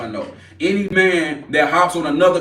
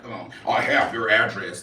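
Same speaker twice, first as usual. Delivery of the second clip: mocking quote; 7 semitones lower, 5 dB quieter than usual.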